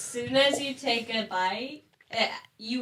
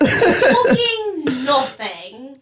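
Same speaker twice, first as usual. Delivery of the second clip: a slow, louder stab at an Irish accent that drifts British; attempted Irish accent; 3 semitones higher, 6 dB louder, slower than usual. Shown as a demonstration. Friend dares him to redo the line, a shaky Irish accent attempt. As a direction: have a go at an Irish accent, stretching it out, leaning British. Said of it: "You gotta say it with an accent".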